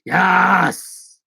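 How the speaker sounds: loud, drawn-out bellow; hammy movie-colonel exclamation; Al Pacino. Shown as a demonstration. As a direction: stretch it into a big shout. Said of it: "I can do Al Pacino from Scent of a Woman".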